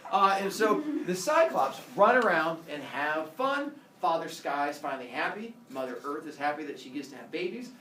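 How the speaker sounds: plain reading voice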